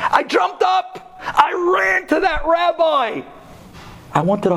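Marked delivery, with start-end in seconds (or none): 0.1-3.2 high, drawn-out exultant cry; impassioned climax. Retold or imitated. Man retells rushing to the rabbi, high impassioned cry. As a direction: cry it out slowly, pitched way up.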